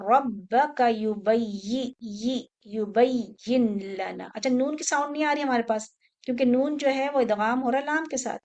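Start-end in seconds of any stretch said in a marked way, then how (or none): 0.1-4.3 drawn-out chanted Quranic recitation; slow recitation. Shown as a demonstration. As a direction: chant it slowly and evenly.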